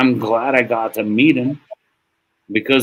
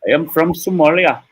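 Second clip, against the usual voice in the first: higher-pitched mock Somali accent; Muhammad's accent; 8 semitones higher, 4 dB louder than usual. Co-worker answering where he is from, in an imitated Somali accent. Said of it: "That's a crazy accent".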